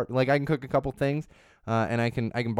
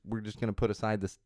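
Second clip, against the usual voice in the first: usual speaking voice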